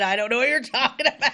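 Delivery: high, quick protest through laughter; laughing retort. Retold or imitated. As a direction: laugh your way through a mock-innocent reply. Laughs through her retold retort.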